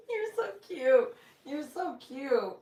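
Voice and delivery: high, loud, slow cooing; gushing. Real, not acted. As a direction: coo at the dog, drawn out. Gushing over the dog.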